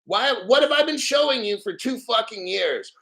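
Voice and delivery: usual speaking voice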